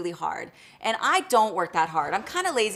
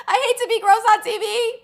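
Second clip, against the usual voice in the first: laughing; 13 semitones higher, 7 dB louder than usual. Real, not acted. Embarrassed about gross topic.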